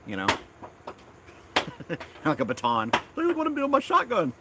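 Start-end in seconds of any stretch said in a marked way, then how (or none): 3.2-4.3 much higher, mocking silly teenager voice; teen mocking his dad's fears, silly voice; mock goofy kid. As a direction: ham up a clueless kid showing off. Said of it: "Like I was gonna twirl it around".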